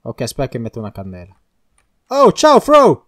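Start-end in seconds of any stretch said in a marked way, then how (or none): 2.1-3.1 high, loud hailing greeting; calling out. Pretend. Player down a hole hails partner above, calling out. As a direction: holler a hello to someone far above.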